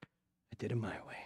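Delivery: a hushed, voiceless whisper of defeat; whispered regret. Retold or imitated. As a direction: breathe it out as if ashamed to say it. Blind Samson muttering to himself.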